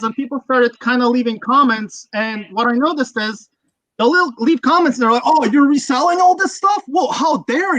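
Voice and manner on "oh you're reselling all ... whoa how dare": imitating an indignant online commenter; higher, mock-scandalized accusation; mock outrage. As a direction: play the offended accuser.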